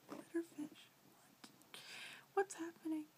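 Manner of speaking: high, hushed, whispery bewilderment; baffled reaction. Retold or imitated. Viewer reliving the glitterfish bit, baffled squeaky whisper. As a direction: squeak it softly, half-whispered, in mock confusion.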